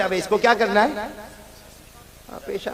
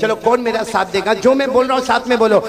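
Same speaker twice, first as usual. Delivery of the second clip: loud, rousing preacher leading the crowd; full-force sermon chant; call-and-response preaching; 5 semitones higher, 10 dB louder, slower than usual. Acted. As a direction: drive the crowd to answer back.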